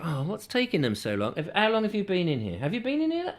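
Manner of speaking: quiet grumbling queue moan; mock moaning in a queue. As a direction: grumble under your breath, rising at the end. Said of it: "stand in here and go".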